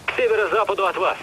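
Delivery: loud hurried radio call; shouting over noise. Acted. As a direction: shout the warning, rushing the end.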